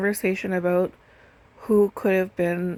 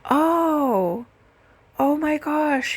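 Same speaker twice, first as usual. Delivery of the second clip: higher, louder stunned "oh my gosh"; a touch of mock dismay, pitched up; caller's shocked sympathy; 8 semitones higher, 4 dB louder, much slower than usual. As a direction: imitate the man catching his breath in shock. Caller realizes dad has died.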